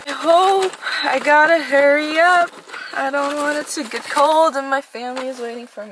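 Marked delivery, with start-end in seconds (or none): 0.1-4.7 louder, higher, rushing; struggling and hurrying; flustered. Real, not acted. Struggling to close the box.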